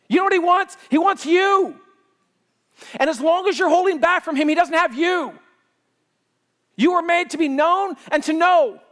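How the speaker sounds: loud, high, driving sermon; preaching at full force. Acted. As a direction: push it out with passion to the congregation.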